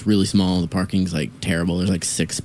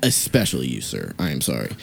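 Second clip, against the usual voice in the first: mock address; 9 semitones lower, 6 dB quieter than usual. Mock reply to the man.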